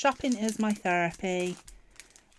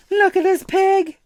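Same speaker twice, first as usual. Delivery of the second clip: loud, high squeal of delight; real excited cry, much higher and louder; really excited; 12 semitones higher, 13 dB louder than usual. Real, not acted. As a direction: shout it out, thrilled by the pig. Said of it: "like, really excited".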